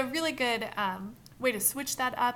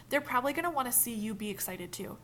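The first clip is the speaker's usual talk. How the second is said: normal talking voice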